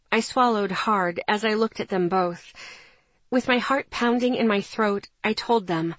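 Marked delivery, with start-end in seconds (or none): none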